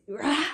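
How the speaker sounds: one short loud roar, imitating his scream; retold roar. Telling how her attacker screamed at her, a loud raw roar. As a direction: let out his roar the way he did it. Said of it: "at the top of his lungs".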